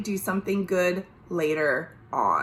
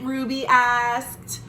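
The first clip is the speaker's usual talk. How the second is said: ordinary delivery, nothing marked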